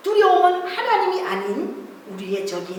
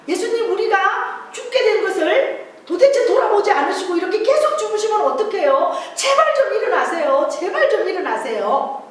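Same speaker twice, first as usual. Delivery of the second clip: higher, louder begging; pleading disciple; 7 semitones higher, 4 dB louder than usual. Acted. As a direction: beg the master to get up. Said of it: "그때 제자가 또 말했어요".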